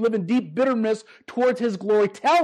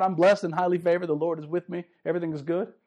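hollow, lower Christianese; mock churchy cheer; 5 semitones lower, 4 dB quieter than usual. Preacher mocking the congregation's fake churchy answers. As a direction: recite the church clichés like a script. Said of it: "I get sick and tired of Christianese".

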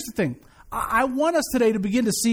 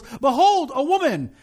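higher exclamation in a grand biblical voice; mock startled Boaz; 5 semitones higher than usual. Preacher voicing Boaz waking to find a woman, mock startled. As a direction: play up a man's midnight surprise.

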